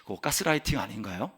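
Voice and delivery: low, soft imitation of a doubter; 의심하는 성도. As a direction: question the pastor quietly and hesitantly. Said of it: "어떤 분은". (낮고 조심스럽게 따지는 성도 흉내)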